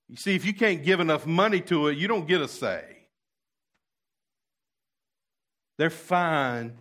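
mock the snobs who think they own the pews; haughty mocking impression of a gatekeeping church; mocking snooty churchgoers. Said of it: "here's what they're saying".

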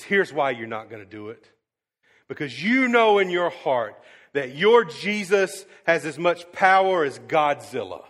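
loud mocking jab; a touch of scornful rage; imitating angry atheist. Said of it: "And he's just angry".

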